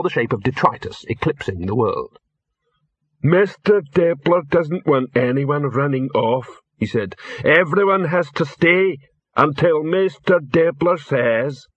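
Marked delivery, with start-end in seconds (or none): 3.2-6.5 slow, ponderous troll voice; plodding character voice, word by word; troll henchman. Acted. 7.4-11.7 slow, higher-pitched troll character voice; ponderous and dutiful; troll henchman. Acted.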